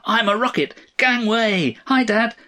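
put-on high, exuberant child's shout; excited little boy. A narrator's reading. Son bursts in to greet dad, high and excited. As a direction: call out high, as a boy playing rocket. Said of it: "his son ran in".

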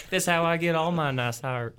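put-on Southern Belle accent. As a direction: play a Southern Belle art thief. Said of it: "Southern Belle high-class art thief".

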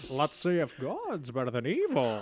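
put-on old film-star voice with swooping pitch; Jimmy Stewart. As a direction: ham up a drawling screen-legend impression. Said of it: "I'm picturing that voice".

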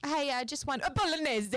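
high mock Italian ordering; hammed-up Italian "bolognese", half laughing; put-on Italian accent. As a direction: playfully fake an Italian accent. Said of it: "put on the Italian accent".

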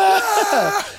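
high laughing roar of a crowd; crowd roar imitation. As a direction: mimic the whole room cheering. Crowd reacts to the catch.

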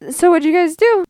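high, friendly mom voice; a lightly voiced chirpy mother; high sweet mom asking cheerfully. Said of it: "my mom, sweet lady, goes".

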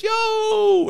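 put-on high shouted hey to an old acquaintance; a big friendly yell, way up high; calling out. Car thief hailing the cop he knows, loud shout.

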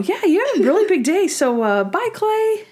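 high sing-song send-off, imitated; mock cheery dismissal. Natalie sends Clay away, in mock cheery dismissal.

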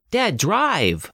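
high, urgent command to dad; acted yell, pitch leaping up; yelled urging. A narrator's reading. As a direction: urge the driver on in alarm. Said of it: "Kayla yelled".

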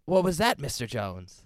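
mock posh British, dropping at the end; posh British accent. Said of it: "a very posh British accent".

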